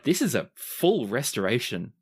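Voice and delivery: usual speaking voice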